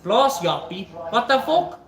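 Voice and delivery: ordinary talk, nothing marked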